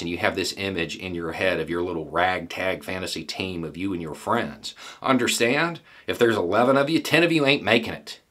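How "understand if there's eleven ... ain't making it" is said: raised, louder, pressing hard on each phrase; forceful warning. Real, not acted. Veteran warning fantasists of the odds, loud and pressing. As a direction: drive the warning home, voice up. Warning would-be fighters about casualties.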